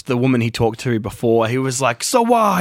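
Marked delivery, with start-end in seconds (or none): 2.0-2.6 high, bright-eyed mock enthusiasm; imitating happy host. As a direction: bounce it up like a host full of energy. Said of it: "He's, like, really happy".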